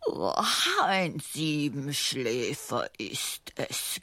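langsam und leise durchs Gähnen gesprochen; gähnt die Worte schläfrig heraus; gähnend. Acted. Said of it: "gähnte Pfiffi Murka". (gähnend und schläfrig)

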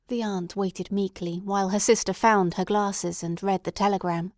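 usual speaking voice

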